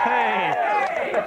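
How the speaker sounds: normal talking voice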